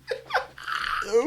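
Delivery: high evil cackle; villain cackle. Acted. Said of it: "You sound like an anime villain".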